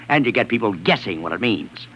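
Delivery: ordinary delivery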